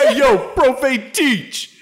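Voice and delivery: high, slow, drawn-out holler; rowdy pupil. Pretend. Student hailing the teacher, high hammed-up call. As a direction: hail the teacher with silly swagger.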